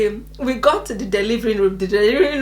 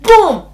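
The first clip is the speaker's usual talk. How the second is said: high, loud falling "boom"; imitated boom; 8 semitones higher, 6 dB louder than usual. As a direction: sound effect of a heavy blow. Imitates another punch of pain.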